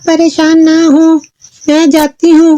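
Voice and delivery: high-pitched daughter voice; reassuring Chulbul. Acted. Daughter reassures mother before leaving, high voice. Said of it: "चुलबुल ने अपनी माँ से कहा".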